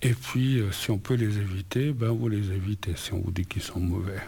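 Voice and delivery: normal talking voice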